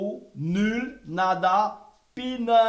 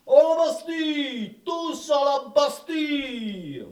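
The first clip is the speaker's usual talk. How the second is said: rallying shout; 6 semitones higher, slower than usual. Acted. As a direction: call everyone to storm the Bastille. Minister rallies the crowd.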